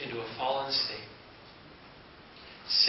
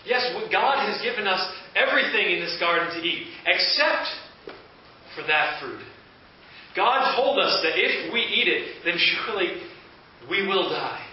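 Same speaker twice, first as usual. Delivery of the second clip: raised, louder and higher, earnest and eager; Eve answering; 5 semitones higher, 6 dB louder than usual. Acted. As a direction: answer the serpent like an eager, insistent young woman. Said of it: "She said".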